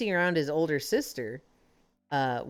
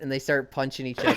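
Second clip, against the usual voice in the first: ordinary delivery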